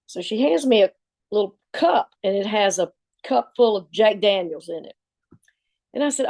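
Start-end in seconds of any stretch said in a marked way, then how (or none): none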